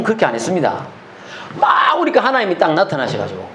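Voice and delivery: normal talking voice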